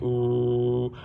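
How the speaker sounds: long, level low "ooh"; steady low demonstration tone; low pitch. Shown as a demonstration. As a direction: draw out "ooh" on one low note. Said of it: "to represent a low pitch".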